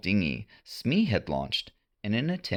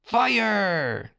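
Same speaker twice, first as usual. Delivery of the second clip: high, sharp order to fire; a boy yelling the command; yelled command; 12 semitones higher, 3 dB louder than usual. A narrator's reading. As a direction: give the signal to attack. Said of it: "John yelled".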